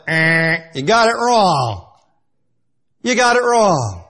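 drawn-out, loud game-show buzzer verdict; heavenly wrong-answer buzzer. Acted. Heaven ruling on Jacob's answer, mock buzzer verdict. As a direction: call the miss loudly, stretching it out. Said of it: "in heaven, it went".

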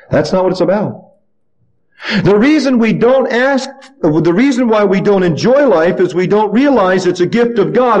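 ordinary delivery

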